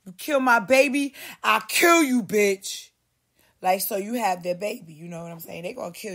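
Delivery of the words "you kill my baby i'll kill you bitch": loud, menacing threat; mock threat. Quoting local men threatening a woman, loud. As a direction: spit the threat like a gangster.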